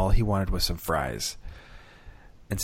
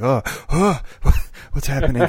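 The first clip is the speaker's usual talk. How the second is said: higher, hammed-up flustered local; mock panicked local; 6 semitones higher, 4 dB louder than usual. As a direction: play the lost native speaker in a fluster. Mocking panicked French listeners.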